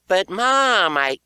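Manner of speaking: put-on high, loud kid protest; pleading child voice; whiny protest.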